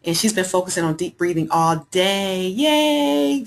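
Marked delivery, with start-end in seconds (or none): 2.5-3.5 cheer. Real, not acted. Cheering a viewer's news.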